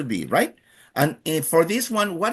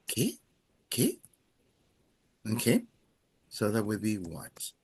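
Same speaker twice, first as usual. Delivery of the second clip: normal talking voice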